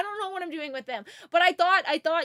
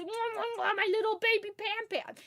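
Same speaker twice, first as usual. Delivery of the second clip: put-on baby talk; 5 semitones higher, 5 dB quieter than usual. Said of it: "kiss the forehead and say".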